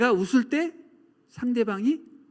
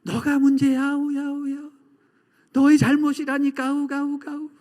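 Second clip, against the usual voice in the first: high, loud blame bouncing back; shouted echo; 8 semitones higher, 6 dB louder than usual. Acted. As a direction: mimic an echo of an angry yell. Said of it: "똑같이".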